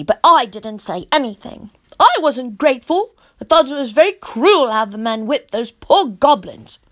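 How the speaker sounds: plain reading voice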